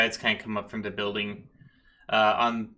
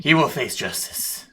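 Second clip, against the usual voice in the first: loud detective's vow; forceful, over-serious detective; righteous detective; 3 semitones higher, 6 dB louder than usual. Acted. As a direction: declare it like a crusading lawman. Detective vows justice.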